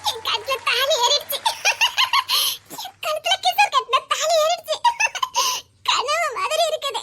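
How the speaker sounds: high, giddy laughing delight; overjoyed laughter. Acted. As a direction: laugh with disbelief between phrases. Bride overjoyed after tali is tied.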